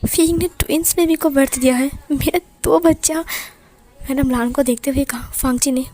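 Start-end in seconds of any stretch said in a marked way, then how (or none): 0.0-3.2 overjoyed new father. A narrator's reading.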